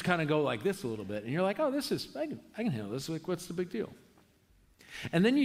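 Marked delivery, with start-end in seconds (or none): 1.6-3.9 lightly acted breezy confidence, pitch jumping about; mock nonchalance. Preacher voicing a rafter before the rapids, mock nonchalant. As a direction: play the unimpressed first-time rafter.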